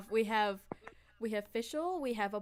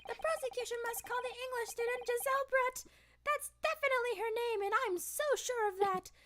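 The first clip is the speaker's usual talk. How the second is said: higher judge voice; 13 semitones higher, faster than usual. Acted. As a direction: declare it like a judge. Voicing the judge.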